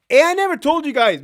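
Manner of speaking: ordinary talk, nothing marked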